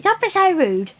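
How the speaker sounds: high, loud telling-off; mock scolding. Cousin scolds after the cigarettes remark, mock scolding.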